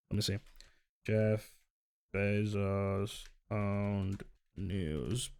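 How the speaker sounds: slow, low, soft word by word; absent-minded muttering. Real, not acted. Checking which paper Bezos owns, muttering slowly to oneself. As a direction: sound it out under your breath while typing. Reading aloud while typing a search.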